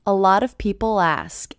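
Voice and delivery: "people ask" joined, L running into the vowel; no break between "people" and "ask"; linked L. Shown as a demonstration. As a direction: glide from the L of "people" straight into "ask". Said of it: "that L as really linking".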